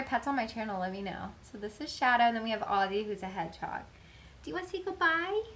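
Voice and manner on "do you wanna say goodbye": much higher, sing-song coaxing voice. Real, not acted. Owner calling her dog over in cooing baby talk. Asking hedgehog to say goodbye.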